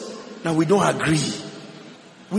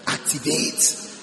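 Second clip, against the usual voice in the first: slow unvoiced whisper; 5 semitones lower, 6 dB quieter, much slower than usual. Shown as a demonstration. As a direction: whisper it slowly, like a secret. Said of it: "Are you getting what I'm saying?".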